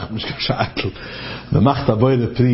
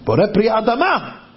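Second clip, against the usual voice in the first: imitating an insistent objector; emphatic objection, pitch jumping up; retold cry; 7 semitones higher, slower than usual. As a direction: counter with a high, mock-shouted blessing. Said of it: "andere schreit".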